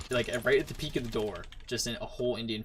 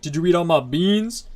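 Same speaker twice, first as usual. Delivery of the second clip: loud, higher-pitched meme imitation; shouted meme quote; 6 semitones higher, 11 dB louder than usual. Quoting a meme about beans to a friend, mock shout. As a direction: belt it out like an outraged meme.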